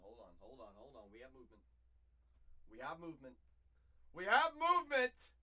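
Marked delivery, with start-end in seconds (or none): 2.7-5.3 rising from plain to loud shout; building excitement. Real, not acted. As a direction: build up to a yell.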